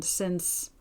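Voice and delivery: "since" dropped low and soft, trailing away; left unfinished. A narrator's reading. Man breaks off pleading friendship, "since" sinking unfinished. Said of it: "he left the sentence unfinished".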